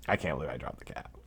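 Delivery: quiet embarrassed mutter; muttered to self. Real, not acted. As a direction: say it under your breath to yourself. Repeats line, then mutters it.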